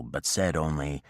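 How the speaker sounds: ordinary delivery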